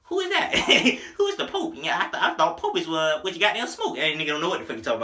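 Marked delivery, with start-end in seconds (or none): 0.0-1.7 high, laughing mock ad-lib; mock homeboy ad-lib. Imitating the homeboy in the studio, high and laughing. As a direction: play the confused homeboy in the booth. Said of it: "He was like".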